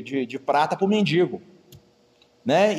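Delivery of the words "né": ordinary talk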